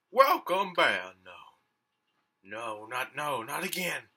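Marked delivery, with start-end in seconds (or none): 0.1-1.1 mock host greeting. Game host greets returning player.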